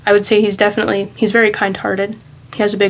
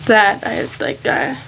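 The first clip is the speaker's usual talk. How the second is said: real choked-up moment, halting with long pauses; quietly overcome, struggling to go on; getting emotional; 6 dB quieter than usual. Real, not acted. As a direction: let the voice falter and fade as emotion catches you. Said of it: "I'm getting a little emotional about that".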